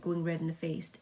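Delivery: ordinary delivery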